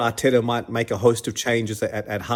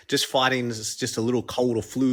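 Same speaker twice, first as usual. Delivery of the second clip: normal talking voice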